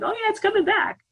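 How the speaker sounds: a cartoonish mind voice, high and eager; mocking the mind. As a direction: play the mind egging you on, pitch jumped way up. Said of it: "'cause the mind's like".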